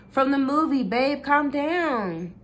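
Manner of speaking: high, slow pleading to calm him; slow high placating plea; desperate calming. A narrator's reading. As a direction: soothe him, drawn out and high. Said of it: "trying desperately to calm him down".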